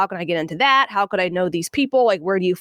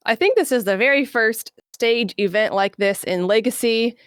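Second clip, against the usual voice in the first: normal talking voice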